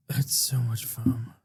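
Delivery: whispering. Real, not acted. Whispers about beer foam.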